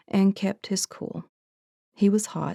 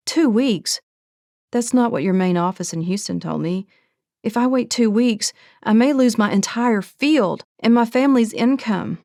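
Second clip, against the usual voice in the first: higher and louder, shocked disbelief; alarmed protest; 5 semitones higher, 5 dB louder than usual. Acted. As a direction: push back, startled and urgent. Sophia alarmed, pushing back.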